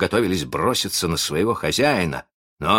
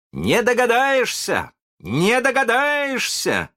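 high, slow, gloating taunt; proud crowing; 3 dB louder, slower than usual. A narrator's reading. Boy taunts the baffled pirates, proud. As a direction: crow it proudly, stretched out and high. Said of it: "кричал с гордостью Питер".

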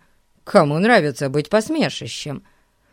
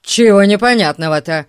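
irritated; 3 semitones higher, 8 dB louder, faster than usual. A narrator's reading. Ern irritated.

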